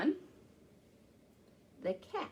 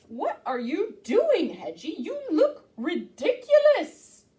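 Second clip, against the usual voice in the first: horse voice; 6 semitones higher, 7 dB louder than usual. Acted. Horse speaks.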